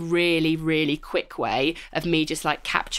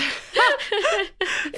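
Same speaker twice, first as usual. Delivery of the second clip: laugh; 3 dB louder than usual. Real, not acted. Host laughs at cohost's reply.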